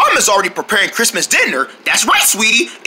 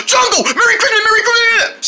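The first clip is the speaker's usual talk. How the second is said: excited; 13 semitones higher than usual. Acted. Gasped excited greeting.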